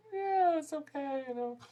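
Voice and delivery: a bored moviegoer shrugging it off; lukewarm shrug. Retold or imitated. Critic mimicking an average viewer, high lazy shrug. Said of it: "they'll just go".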